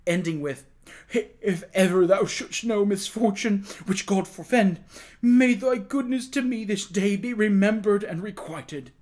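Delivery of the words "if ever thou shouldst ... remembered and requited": high, loud, fervent thanks; passionate gratitude. A narrator's reading. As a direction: pour out thanks as a reprieved prisoner. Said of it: "burst into passionate grat-, expressions of gratitude".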